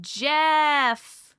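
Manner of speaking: drawn-out mock yell of a name; lightly hammed-up shout. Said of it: "Someone just yelled".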